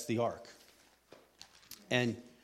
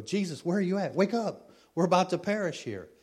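acted-out alarm, higher and louder; mock desperate cry for help; panicked plea; 7 semitones higher, 4 dB louder than usual. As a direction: call out urgently to wake a sleeper.

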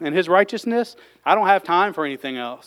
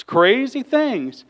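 ordinary delivery

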